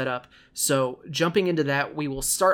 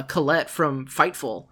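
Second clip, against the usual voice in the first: ordinary talk, nothing marked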